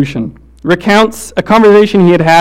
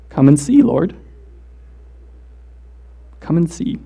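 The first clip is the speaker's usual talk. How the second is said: soft answer; 3 semitones lower, 6 dB quieter than usual. Acted. Mourners answer Jesus softly.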